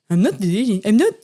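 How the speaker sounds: muttering a mock version of his line. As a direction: parrot him back in a silly put-on voice. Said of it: "saying that in that voice to myself".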